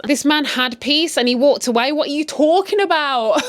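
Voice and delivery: high, loud disbelieving rant; what are you talking about, outraged; indignant rant. Real, not acted. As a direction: push back hard on bad advice. Ranting at the advice.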